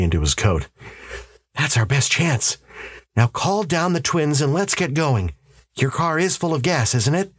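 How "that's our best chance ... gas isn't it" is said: higher, insistent husband's voice; urgent husband. A narrator's reading. Husband warning his wife of a powerful enemy, urgent. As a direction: press the warning on a disbelieving wife.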